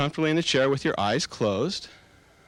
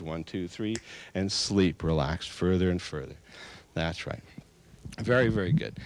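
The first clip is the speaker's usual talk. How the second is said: lower, softer, lulling count; hypnotic sleep command; 6 semitones lower, 4 dB quieter than usual. Acted. Hypnotist counts volunteers into deep sleep, lulling. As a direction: ease them down into sleep.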